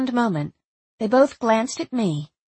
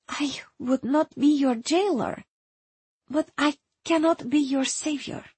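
almost apologetic; 5 semitones higher than usual. A narrator's reading. Erzsébet refuses to be the visitor's savior, almost apologetically. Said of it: "almost apologetically".

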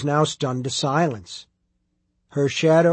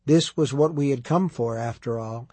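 plain reading voice

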